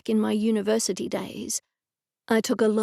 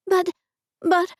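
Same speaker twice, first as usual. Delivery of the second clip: sputtering; 8 semitones higher than usual. Acted. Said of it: "I sputtered".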